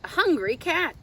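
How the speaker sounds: plain reading voice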